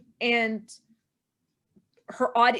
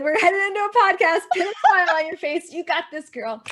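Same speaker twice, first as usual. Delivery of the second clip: self pep talk; 5 semitones higher, 7 dB louder than usual. Retold or imitated. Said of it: "I was like".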